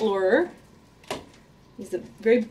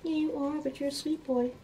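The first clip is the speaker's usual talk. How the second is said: higher, soft cooing; 6 semitones higher, 9 dB quieter than usual. Real, not acted. Owner cooing to her huffy old rat. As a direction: reassure the grumpy rat sweetly.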